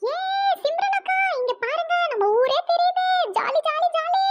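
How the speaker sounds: high, loud cries of delight; excited shout. Acted. Asin shouts to Simran about the balcony view, thrilled and loud. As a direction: squeal over the view like a child.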